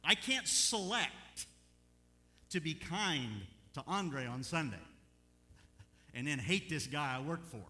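slow, loud, high pleading prayer; fervent prayer. Retold or imitated. Preacher relives his altar prayer, loud and slow. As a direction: pour it out to God at the altar. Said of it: "Lord, I am sorry".

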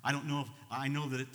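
usual speaking voice

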